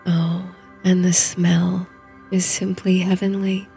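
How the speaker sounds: ordinary delivery, nothing marked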